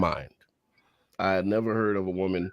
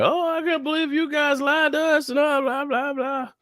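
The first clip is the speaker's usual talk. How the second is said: high mocking voice; mock the outraged public; mock whiny fans. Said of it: "apparently everybody's going crazy, like".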